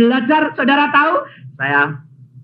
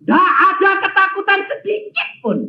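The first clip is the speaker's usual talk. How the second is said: triumphant shout. Acted. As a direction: proclaim there is no fear. Triumphant climax of testimony.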